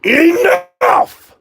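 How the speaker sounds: very high, loud yell; shouting at dog. Real, not acted. Owner to his barking dog, high-pitched shout. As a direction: shriek the command at a barking dog.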